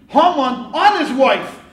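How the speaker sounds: drawn out and high with mock shock; 1 shocked reveal. Rabbi revealing the king's view, in mock shock. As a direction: gasp at the scandal. King sees Haman on queen.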